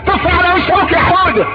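put-on high, egging on a child; gleeful mother. A mother urging her son Hoda to defy his father, gleeful. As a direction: cheer the boy on brightly.

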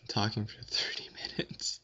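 tired soft whisper. Real, not acted. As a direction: breathe it out quietly. Whispered aside.

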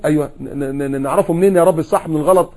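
ordinary talk, nothing marked

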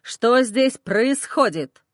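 plain reading voice

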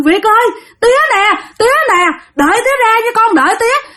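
high, urgent wail to a son; father calling after his child in anguish; desperate crying out. Acted. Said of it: "ổng la làng lên". (la làng gọi con thảm thiết)

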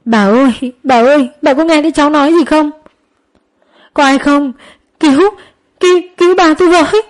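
high, fast frantic calling; calling repeatedly. A narrator's reading. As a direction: plead over and over for help. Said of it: "miệng gọi liên hồi". (gọi liên hồi, gấp gáp)

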